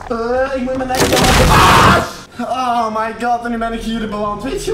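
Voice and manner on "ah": loud startled scream; jump-scared in VR, a loud scream; sudden scream. Real, not acted. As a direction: scream at the shock.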